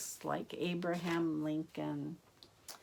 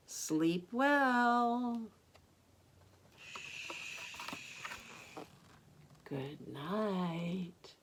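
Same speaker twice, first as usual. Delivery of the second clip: tender goodnight; 2 semitones higher, much slower than usual. A narrator's reading. Gentle ending of bedtime book.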